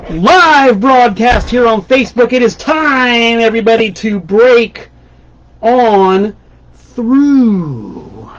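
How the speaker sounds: slow, drawn-out show announcement; live show opener, drawn out slowly; show-opening announcer. Acted. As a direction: announce the broadcast, stretching out the big words.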